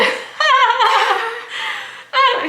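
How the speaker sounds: high-pitched cackling laugh; long, squealing laugh; helpless laughter. Real, not acted. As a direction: crack up at your own story.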